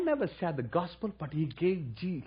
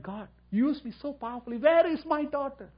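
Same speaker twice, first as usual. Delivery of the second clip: crying out to God; 9 semitones higher, 5 dB louder than usual. Retold or imitated. Grieving father pleading with God about his daughter. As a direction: cry out to God for the lost daughter. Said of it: "And I cried".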